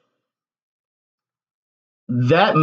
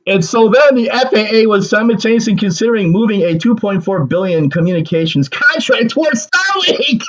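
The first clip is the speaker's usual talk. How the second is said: higher, stunned re-reading of the line; disbelieving second read, pitched up; incredulous reread; 7 semitones higher than usual. Shown as a demonstration. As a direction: read it again like you can't believe it. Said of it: "go back and re-, read that".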